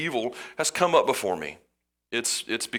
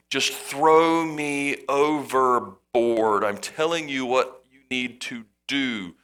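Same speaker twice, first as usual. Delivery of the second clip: very slow, drawn-out words; slow insistence; much slower than usual. Acted. Jonah insisting to the sailors, very slow and drawn out. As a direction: spell it out slowly, insisting.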